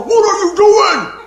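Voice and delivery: mock yell at the daydreaming outfielder; teammates yelling. Teammates yelling at the boy who missed the ball, mock yell. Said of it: "everybody's going".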